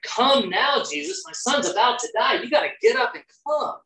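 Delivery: a father begging Jesus to hurry; mock desperate plea. Royal official voiced, pleading.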